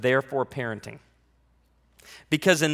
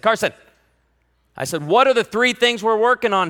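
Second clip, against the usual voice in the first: calling out; 5 semitones higher, 6 dB louder than usual. Retold or imitated.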